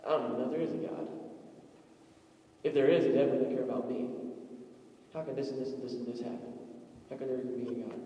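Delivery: soft, low, hurried doubt; bitter questioning of God; despairing doubter. Retold or imitated. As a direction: rush it quietly, losing faith.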